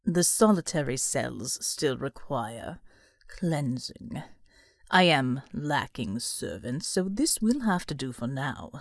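low, slow and cold; dark man voice. Acted. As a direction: speak as a sinister master.

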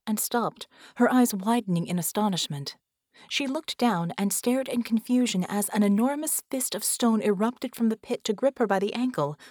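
plain reading voice

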